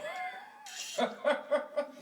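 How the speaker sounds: helpless breathy laugh; breaking into laughter. Real, not acted. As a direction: crack up, unable to hold it. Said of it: "I couldn't keep a straight face".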